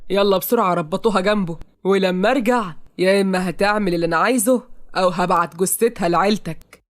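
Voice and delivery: cold villain giving orders; menacing villain. Acted. Villain orders her tied up, threatening. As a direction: threaten them in an altered villain voice.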